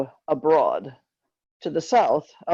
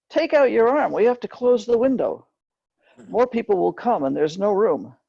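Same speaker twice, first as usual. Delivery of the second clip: passengers shouting orders; 6 semitones higher than usual. Retold or imitated. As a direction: order her to pull her arm out now. Passengers ordering her to withdraw arm.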